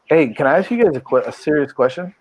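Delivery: ordinary talk, nothing marked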